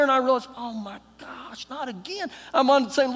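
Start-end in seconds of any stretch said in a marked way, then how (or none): 0.5-2.3 hushed dismay. Retold or imitated. Traveler separated from his wife, softly dismayed.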